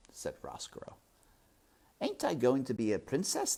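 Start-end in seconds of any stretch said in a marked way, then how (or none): 2.0-3.6 put-on high, hopeful servant girl; naive servant girl. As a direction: plead hopefully, pitched up.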